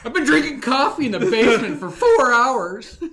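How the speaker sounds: loud, high Chris Farley bellow; mock motivational coach. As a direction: shout it like a manic motivational speaker. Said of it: "Chris Farley motivational speaker impression".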